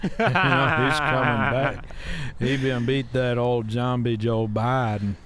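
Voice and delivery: put-on folksy Southern old-timer; Southern accent. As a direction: spin it out in a Southern twang. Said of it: "You did practice that voice".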